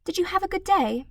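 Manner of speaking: put-on high, bright young girl; a cheerful little girl asking sweetly; high child's voice, pleasant. A narrator's reading. Matilda voiced by narrator.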